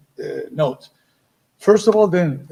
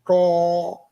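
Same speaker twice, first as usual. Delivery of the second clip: hard attack. Shown as a demonstration. Teacher showing the wrong hard tongued attack. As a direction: spit the note with the tongue. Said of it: "instead of".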